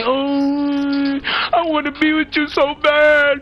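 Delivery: high, whiny fake-sobbing plea, slowed on the love line; mock crying boyfriend. Mocking a man who cries to his girlfriend, high and whiny sobbing. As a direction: ham up a blubbering boyfriend begging her not to leave. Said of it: "They start crying like bitches".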